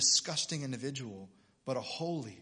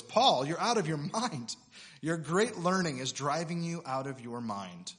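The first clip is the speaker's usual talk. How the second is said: Festus's loud voice; 3 semitones higher, 5 dB louder than usual. Acted. Preacher reads the governor's outburst to Paul, loud voice. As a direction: call it out loud over the speaker. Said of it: "Festus said in a loud voice".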